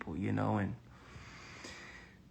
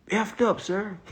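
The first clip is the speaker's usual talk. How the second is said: blunt honest answer; 8 semitones higher, 4 dB louder, slower than usual. Shown as a demonstration. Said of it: "you need to say".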